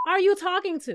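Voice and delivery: indignant are you talking to; sassy indignant. Retold or imitated. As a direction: press the you, offended. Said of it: "The way I would have asked Scotty".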